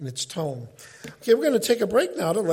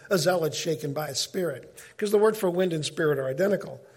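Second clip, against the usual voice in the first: normal talking voice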